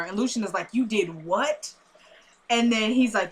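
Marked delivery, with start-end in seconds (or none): 0.7-1.7 imitated disbelief. Voicing character's disbelief at a confession.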